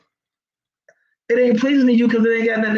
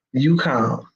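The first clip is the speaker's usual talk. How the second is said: calm; 7 semitones lower than usual. Shown as a demonstration. Contrasting chaos with calm response.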